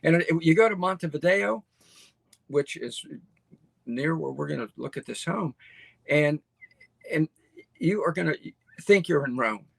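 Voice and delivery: usual speaking voice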